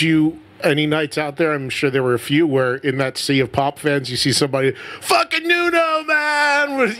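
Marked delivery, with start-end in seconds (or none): 5.0-6.9 fan yelling. Retold or imitated. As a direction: holler it across a crowded arena. Fan yelling from pop crowd.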